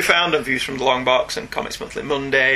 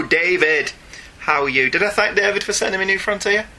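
ordinary delivery

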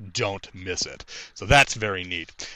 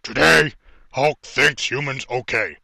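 slow, loud booming Hulk voice; big dumb brute, every word heavy; dramatic reading; 3 dB louder than usual. Acted. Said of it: "I'm gonna attempt to do a dramatic reading here".

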